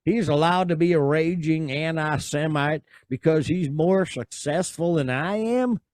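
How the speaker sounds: mock Southern read of the tweet; Southern accent. Reading Draymond's tweet to the cohost in a Southern accent. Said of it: "I'm just gonna do the Southern one".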